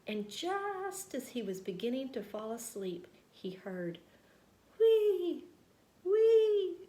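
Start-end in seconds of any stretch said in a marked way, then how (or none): 4.8-6.8 high, loud whistling snore; snoring whistle. A narrator's reading. Big Mouse snoring.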